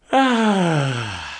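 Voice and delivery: long open relieved exhale after laughing; relieved sigh. Shown as a demonstration. Pastor showing the congregation the breath after a laugh, a relieved sigh. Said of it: "They go".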